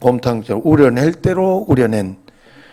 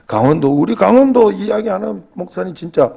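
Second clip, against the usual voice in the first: imitating an upset churchgoer, higher pitched; mock indignant complaint; 7 semitones higher than usual. Retelling a member's complaint about Gangwon-do being left out, mock indignant. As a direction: complain in a high, put-out voice. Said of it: "화를 내더라니까".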